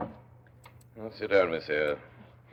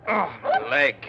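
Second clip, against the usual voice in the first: pained groan; 9 semitones higher, 7 dB louder, slower than usual. Acted. Thrown rider hurt.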